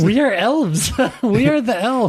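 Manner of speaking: high, excited elf revelation; amazed delight. Pretend. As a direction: announce it in giddy wonder. Playing amazed Finns.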